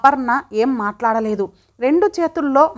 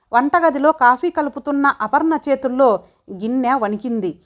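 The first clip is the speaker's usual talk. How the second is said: usual speaking voice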